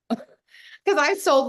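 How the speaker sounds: laughing out the words, high and loud. Real, not acted. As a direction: burst into a laugh mid-sentence.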